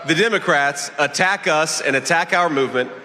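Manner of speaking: high, projected stump-speech delivery; rally speech. Real, not acted. Candidate attacks the opposing party before a crowd, rally speech. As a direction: address the crowd in measured, emphatic phrases.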